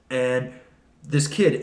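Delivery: usual speaking voice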